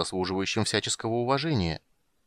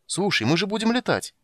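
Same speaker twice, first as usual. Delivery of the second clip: excited; 10 semitones higher, 3 dB louder than usual. A narrator's reading. Impatient kender urging on.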